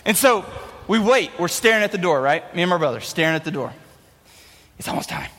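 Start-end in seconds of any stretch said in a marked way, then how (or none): 4.8-5.3 whispered, no voice at all; excited whisper. Retold or imitated. A boy whispering to his brother at the door. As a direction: hush it to your brother.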